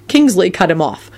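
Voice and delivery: ordinary delivery, nothing marked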